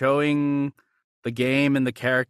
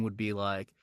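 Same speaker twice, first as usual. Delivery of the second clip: usual speaking voice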